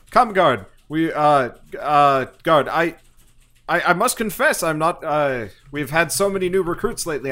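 a pompous officer backpedaling awkwardly; flustered commander. Acted. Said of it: "he's gonna be like".

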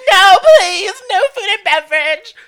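high, loud fake-sob begging; imitating her friend's pleading wail; mock crying plea. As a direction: beg through hammed-up tears.

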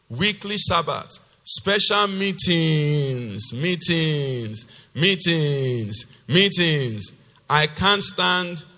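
loud, slow, exasperated declaiming; forceful scripture reading. Acted. Preacher voicing God fed up with meetings, loud and drawn out. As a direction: stretch out each repeated "meetings". Reads God's tirade with drawn-out words.

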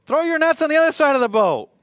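loud shouted command from the shore; calling out loud. Acted. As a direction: bellow the instruction from the shore. Voice calls instruction from shore.

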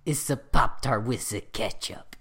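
the same put-on French accent, slow at the end; a slow, cheesy French ad read; mock French accent. Keeps teasing his cohost with a slow, mock French accent. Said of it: "I hate you so much".

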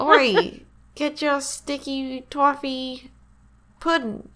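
high British street-seller call; British accent attempt. Shown as a demonstration. Vendor selling pudding, mock British accent. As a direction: hawk it slowly in a hammed-up British accent. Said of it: "put a British accent on sticky toffee pudding".

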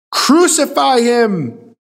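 loud, high, drawn-out crowd shout; shouted cry. A narrator's reading. Crowd shouts back at Pilate, cried out. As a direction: cry it out slowly as the mob. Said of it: "responding with a shout, cried out".

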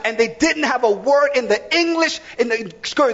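ordinary talk, nothing marked

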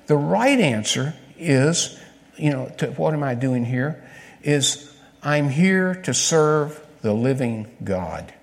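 slow, deliberate, every word weighted; slow emphatic answer. Shown as a demonstration. Preacher giving the right answer, slow and weighty. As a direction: spell out the answer slowly.

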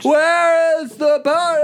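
put-on very high, slow, laughing drunk squeal. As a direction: overblown drunk imitation, laughing through it. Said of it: "you sound like a drunk person".

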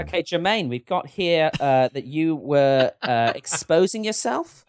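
halting New Zealand band manager; New Zealand accent. Shown as a demonstration. Manager confronts Jermaine at band meeting, New Zealand accent. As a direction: raise an awkward matter in a New Zealand accent. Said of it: "a New Zealand accent at all".

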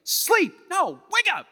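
high, loud, building to a shout; rising fury at sleeping friends; Jesus scolding disciples, shouting at the end; fed up, betrayed. Acted. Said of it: "He's fed up".

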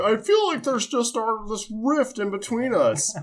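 milk it like a cheesy melodrama hero; high, slow hammed-up sincere confession; mock sappy line. Mocking Gordon's cheesy line.

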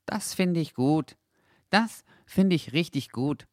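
light up with joy; delighted. A narrator's reading. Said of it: "freute sich der kleine Hund". (freudig, viel höher)

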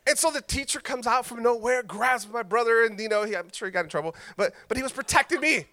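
normal talking voice

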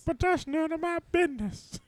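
very high frog puppet voice; Kermit the Frog. Acted. Showing co-hosts the frog, high-pitched puppet impression. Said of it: "That's, that's Kermit the".

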